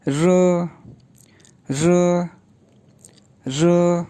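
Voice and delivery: र said as a curled Mandarin r; र, retroflex and buzzing; र like the Mandarin syllable ri; pinyin ri. Shown as a demonstration. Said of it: "और आर pronounce होता है".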